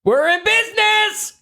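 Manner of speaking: high, slow triumphant scream; guy screaming. Acted. Quote for the guessing game, high slow scream. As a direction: scream it out like a soldier cheering. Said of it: "a guy screaming".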